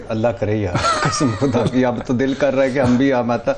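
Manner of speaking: laughing through the words; real laughter while joking. Real, not acted. Joking that he wishes for money.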